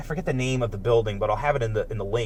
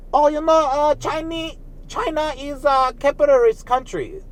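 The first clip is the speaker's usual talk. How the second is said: high, put-on Japanese accent imitating a friend; mock Japanese accent; 11 semitones higher than usual. Retelling to viewers what a mainland friend said, in a mock Japanese accent. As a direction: parody a Japanese speaker, pitched way up. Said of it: "I said it like a Japanese person".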